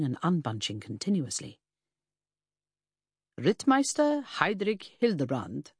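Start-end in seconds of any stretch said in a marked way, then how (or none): 3.4-5.7 stiff German officer. A narrator's reading. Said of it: "with a stiff bow of the head".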